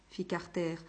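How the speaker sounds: plain reading voice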